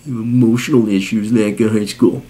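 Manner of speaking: low, dopey put-on voice; mocking imitation. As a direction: parrot the excuse with contempt. Mocks whiner's excuse.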